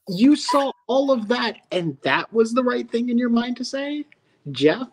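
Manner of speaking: high-pitched, incredulous rebuke; indignant disbelief. Pretend. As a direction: scold in disbelief, pitched well up. Outraged rebuke addressed to absent host.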